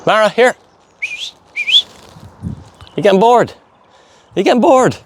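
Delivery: high teasing voice to the dog. Real, not acted. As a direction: coax her up high.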